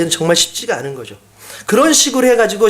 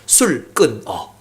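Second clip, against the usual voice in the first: low, very slow, quiet command; God's quiet command; 7 semitones lower, much slower than usual. Retold or imitated. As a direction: drop low and lay out each syllable, "술 끊어". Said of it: "딱 세 글자 주세요". (낮고 아주 느리게 "술 끊어")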